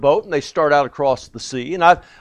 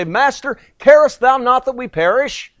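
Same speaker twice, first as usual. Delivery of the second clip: high, frantic plea to the master; panicked cry; 8 semitones higher than usual. Retold or imitated. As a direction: cry out over the storm in fear. Disciples cry out in storm.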